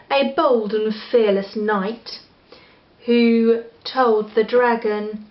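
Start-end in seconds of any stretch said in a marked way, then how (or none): none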